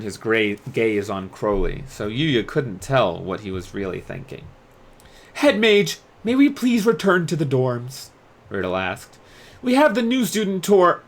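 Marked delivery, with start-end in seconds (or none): 5.3-11.0 higher, crisp and commanding, then easing off; a strict prefect who decides to let it go; stern student leader. Acted. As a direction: dorm leader scolding the outsider, then relenting. Riddle pressing the head mage.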